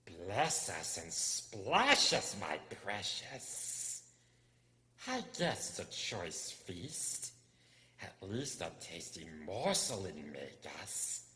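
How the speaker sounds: slow, hissing creature voice; Gollum hissing. Acted. Gollum hisses at the lost hobbit, slow and hungry. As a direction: hiss it out slowly, savouring a meal. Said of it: "whispered and hissed".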